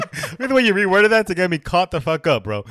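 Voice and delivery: loud, high laughing outcry; playful shouted accusation, way up. Real, not acted. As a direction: call him out, cracking up and loud. Laughing accusation, much louder.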